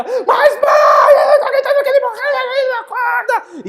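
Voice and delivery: put-on high, loud, fast bawling kid; child bawling. Boy bawling to his mother about his dead turtle. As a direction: bawl it out, half laughing. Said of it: "Vou berrar".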